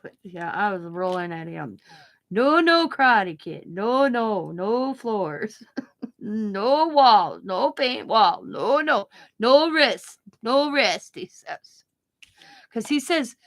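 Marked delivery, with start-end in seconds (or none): 2.3-11.1 slow, drawn-out mock bans; playful, stretched doctor mimicry; doctor impression. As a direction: imitate the doctor, swooping up and falling on each no. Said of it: "the way he talked".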